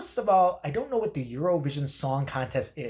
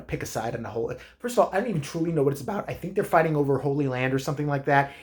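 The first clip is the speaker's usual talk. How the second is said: usual speaking voice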